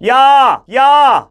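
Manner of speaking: loud, high projected shout; full-out hailing call; calling far away. Shown as a demonstration. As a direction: fling the voice forward to someone distant. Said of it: "멀리 있는 사람 부르듯이".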